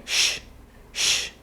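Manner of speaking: sh as a pure voiceless hiss; som não vozeado. Shown as a demonstration. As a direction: isolate sh with no vowel. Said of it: "O último som é". (sh sem vibrar a garganta)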